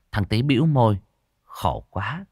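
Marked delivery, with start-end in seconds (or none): none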